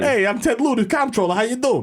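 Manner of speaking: high, hammed-up New York accent; exaggerated New Yorker introduction. Comptroller saying hello, in a broad New York accent. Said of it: "us doing a New York accent".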